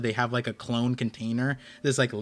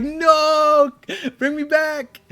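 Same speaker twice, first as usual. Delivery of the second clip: much higher and louder, pleading no; patient begging the nurse, high loud plea; mock desperate plea; 12 semitones higher, 6 dB louder, much slower than usual. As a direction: cry out to be brought back, high and loud. Pleading not to be sedated.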